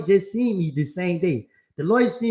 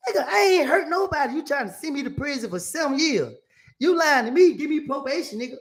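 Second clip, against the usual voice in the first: indignant protest; 7 semitones higher than usual. Retold or imitated. Acted argument with the lawyer.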